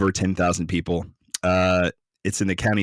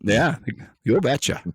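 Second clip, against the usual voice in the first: high Fargo-style "you betcha"; Wisconsin accent; 5 semitones higher than usual. Shown as a demonstration. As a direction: Minnesota nice accent. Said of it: "I'm watching fucking Fargo".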